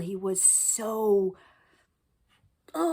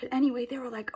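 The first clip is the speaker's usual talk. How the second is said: normal talking voice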